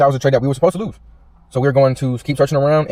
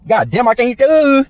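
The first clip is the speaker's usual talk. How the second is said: frustrated outburst; 12 semitones higher, 6 dB louder, slower than usual. Real, not acted. Tongue-tied over trade levels.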